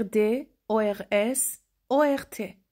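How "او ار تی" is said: normal talking voice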